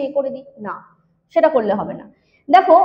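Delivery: normal talking voice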